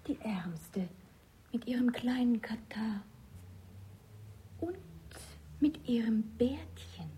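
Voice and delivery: teasing unvoiced whisper; mock him under your breath. Nun mocks the disguised man's beard in a whisper.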